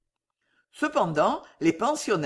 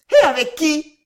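high, very loud exclaimed question; shocked question; 8 semitones higher, 9 dB louder than usual. A narrator's reading. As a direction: demand the name in scandalised shock. Women shocked by news of elopement.

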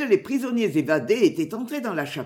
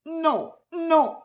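higher, narrated sob on two words; sobbing refusal; 8 semitones higher than usual. A narrator's reading. Christabel refuses to flee with Alan, sobbing. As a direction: plead no through acted sobs.

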